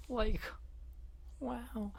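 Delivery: high, cracking cartoon slacker voice; Shaggy. Streamer attempting an old cartoon impression for chat, Shaggy voice. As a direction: pitch it way up, drawn out. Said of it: "perfect impression of Shaggy from Scooby-Doo".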